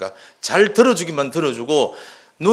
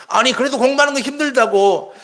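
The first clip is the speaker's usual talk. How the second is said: put-on higher, pushy cut-in over the child; brushing off; 10 semitones higher, 4 dB louder than usual. Parent cutting into the child's complaint, pushy. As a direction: talk right over the kid. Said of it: "자기 얘기를 바로 치고 들어버립니다".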